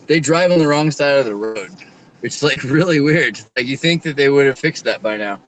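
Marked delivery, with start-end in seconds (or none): none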